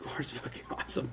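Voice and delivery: whispered excitedly; hushed, breathy aside. Real, not acted.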